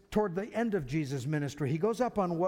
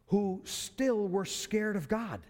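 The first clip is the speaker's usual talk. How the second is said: ordinary delivery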